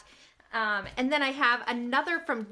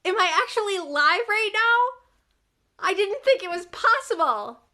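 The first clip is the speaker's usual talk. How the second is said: thrilled, high and loud disbelief; can't believe the stream works; excited; 6 semitones higher, 7 dB louder than usual. Real, not acted. Streamer thrilled her live finally works, excited. First working livestream, thrilled.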